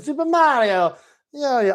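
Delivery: high-pitched, drawn-out mock Italian; Italian accent. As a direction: sing out a ridiculous cartoon Italian accent. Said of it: "ridiculous Italian accent".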